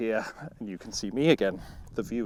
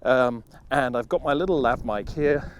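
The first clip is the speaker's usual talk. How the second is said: normal talking voice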